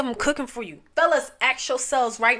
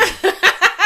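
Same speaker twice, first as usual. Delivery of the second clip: laughing; 6 semitones higher, 8 dB louder than usual. Real, not acted. Laughing at her joke.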